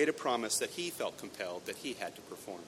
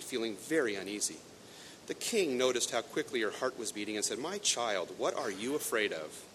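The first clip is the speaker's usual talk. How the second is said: story narration, lightly voiced; storytelling read; 2 semitones higher, 5 dB louder than usual. A narrator's reading. Preacher reading the Grimm tale to the congregation, storytelling.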